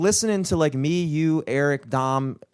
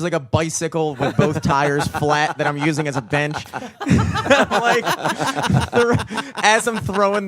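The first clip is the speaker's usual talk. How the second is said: laughing; 3 semitones higher, 3 dB louder than usual. Real, not acted. Laughing at own story.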